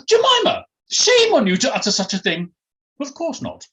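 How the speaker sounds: very high, scandalised aristocratic squeal; indignant posh lady. Acted. Posh lady denying her friend's accusation, indignant. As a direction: scold her in hammed-up outrage.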